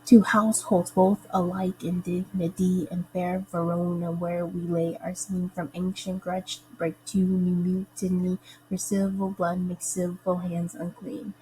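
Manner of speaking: measured verse reading beating every second syllable, "two HOUSE-holds BOTH a-LIKE"; sing-song stress on alternate syllables from "Two households" on, weak then strong; emphasized iambic pentameter. Shown as a demonstration. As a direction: read "Two households both alike" in weak-strong beats through to "unclean". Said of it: "then emphasize the iambic pentameter".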